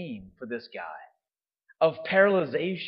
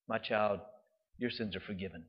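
low, soft and tender; gentle pronouncement; 6 semitones lower, 8 dB quieter than usual. Retold or imitated. Jesus forgiving the paralyzed man, gentle pronouncement. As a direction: lower the voice to quietly absolve.

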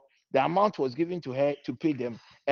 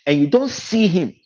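louder and slower, leaning on don't; loud slow emphasis; 4 semitones higher, 9 dB louder than usual. Retold or imitated. Preacher quotes a man of God to his audience, loud and slow. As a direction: drive the line home, slowly. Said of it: "he says".

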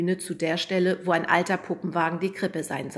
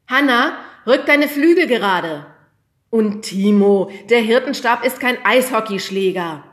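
loud, raised teacher's call across the stage; 5 semitones higher, 12 dB louder than usual. A narrator's reading. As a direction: call the kids to order, loud and high. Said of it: "rief der Lehrer".

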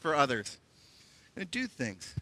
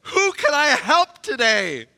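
high, bright with eagerness, higher voice; a hammed-up eager helper, higher and louder; eager churchgoer; 4 semitones higher, 3 dB louder than usual. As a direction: gush like someone thrilled to go help. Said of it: "It's that arm waving".

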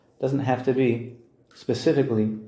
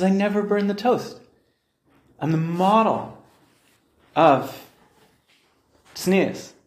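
indignant wife; 7 semitones higher, slower than usual. Retold or imitated. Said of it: "She says".

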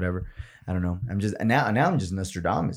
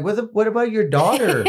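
indignant retort; 11 semitones higher than usual. Retold or imitated. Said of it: "And I'm like".

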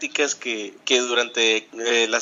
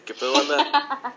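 high, playful put-on sexy voice; sensual voice; 11 semitones higher than usual. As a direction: tease them with a fake seductive voice. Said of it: "que te escuchen tu voz sensual".

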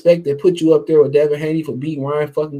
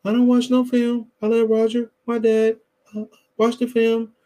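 higher, halting imitation with pauses; Mayweather impression; 3 semitones higher than usual. Commentator mimicking a fighter who skips film study, higher halting voice. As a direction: imitate a boxer casually brushing off a question. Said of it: "that old Mayweather shit".

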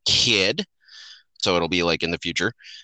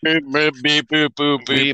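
slightly high robot beeps; playful robot beeping; toy robot voice; 4 semitones higher, slower than usual. Pretend.

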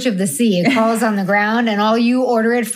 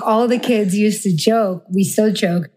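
ordinary talk, nothing marked

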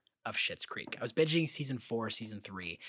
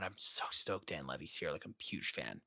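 excitement slipping out; 6 semitones lower, 6 dB quieter than usual. Retold or imitated. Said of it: "Kinda let it sl-, my excitement slip".

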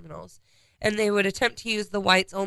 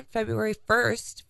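ordinary talk

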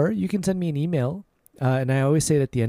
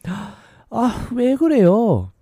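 exasperated K-drama woman, higher and louder; drama heroine; 8 semitones higher, 4 dB louder than usual. Retold or imitated. Woman in a Korean drama complaining dramatically. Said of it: "the women in the Korean dramas".

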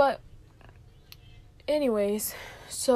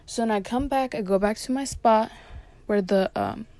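normal talking voice

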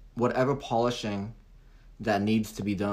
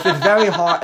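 laughing; 9 semitones higher, 12 dB louder than usual. Real, not acted. Laughing while admitting difficulty.

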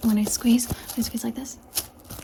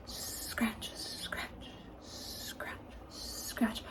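slow drawn-out whispered scratch, scratch; 15 dB quieter, much slower than usual. Shown as a demonstration. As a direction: whisper each "scratch" slowly with gaps between. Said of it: "Slow like this, slow like this".

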